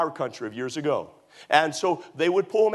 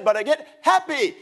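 happy; 9 semitones higher, 4 dB louder than usual. Retold or imitated. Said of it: "and he said".

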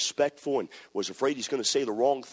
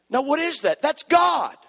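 much higher and louder in wonder; excited exclamation; 13 semitones higher, 6 dB louder than usual. Real, not acted. Preacher marveling at God's work before the congregation. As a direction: exclaim it like a revelation.

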